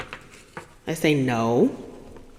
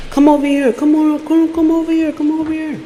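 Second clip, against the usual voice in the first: high, repetitive mock wheedling; imitated pestering plea; insistent coaxing; 9 semitones higher, 4 dB louder than usual. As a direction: nag someone to come closer, pitched up. Imitating his repeated pressure.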